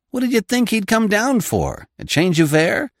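high, scornful impatience; impatient. Acted. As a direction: snap it impatiently. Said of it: "said Mister Coots impatiently".

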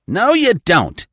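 high, loud shout to stop him; sharp shout. Acted. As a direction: bark it out to block his way. Coutts stops Smith leaving.

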